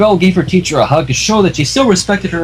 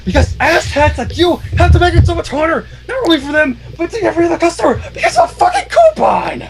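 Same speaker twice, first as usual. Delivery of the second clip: high-pitched tirade building to a shriek; furious rant; 12 semitones higher than usual. A narrator's reading. Teacher tells off old mare, building fury. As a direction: scold her harder and harder. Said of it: "Cheerilee keeps ranting".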